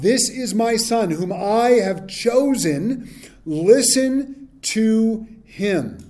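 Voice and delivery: very slow, solemn divine pronouncement; voice of God. Acted. God affirming his Son to the disciples, very slow and solemn. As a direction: draw out each word like God speaking. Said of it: "they heard the voice of God".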